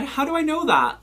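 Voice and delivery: how on earth would I know; baffled exclamation. Real, not acted. Answering a random viewer question, baffled and exclaiming.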